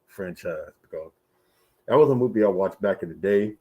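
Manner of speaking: ordinary talk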